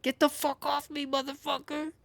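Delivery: high, squeaky angry animal voice; voicing the boar. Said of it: "'cause it's like".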